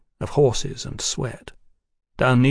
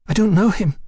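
high, louder protest in a woman's voice; insistent; 9 semitones higher, 5 dB louder than usual. A narrator's reading. Woman denying she knows Houston, insistent. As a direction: insist on it, pitched right up. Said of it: "she insisted".

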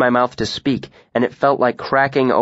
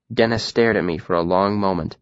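ordinary delivery

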